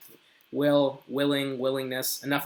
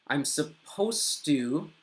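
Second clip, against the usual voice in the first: slow careful model; much slower than usual. Shown as a demonstration. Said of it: "you could say".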